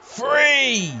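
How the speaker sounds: building to a high, loud three; excited pin count. Real, not acted.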